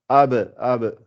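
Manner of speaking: ab with the b bouncing; ab, the b released with a bounce; qalqala bounce. Shown as a demonstration. Teacher shows the bounce on ab. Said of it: "C'est comme le ba".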